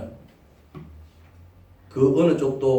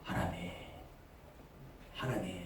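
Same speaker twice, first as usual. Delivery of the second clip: quiet pleading prayer; 2 semitones lower, 21 dB quieter than usual. Retold or imitated. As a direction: call up to heaven in a hush. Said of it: "하늘을 쳐다보는 거예요".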